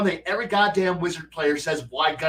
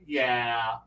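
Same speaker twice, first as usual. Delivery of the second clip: each yeah drops lower and softer until it's barely there; sinister game master trailing off into a hush; ominous fading yeah; 10 semitones lower, 7 dB quieter, much slower than usual. Retold or imitated. Game master teasing the panicked players, fading to a menacing hush. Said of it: "And I'm like".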